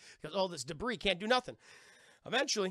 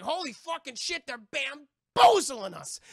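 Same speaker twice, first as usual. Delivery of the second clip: high, loud mock-pirate alarm; panicked captain's outburst, played big; alarmed shout; 7 semitones higher, 10 dB louder, slower than usual. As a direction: yell it in hammed-up shock, building on the key word. Acted alarm of Barbossa under attack.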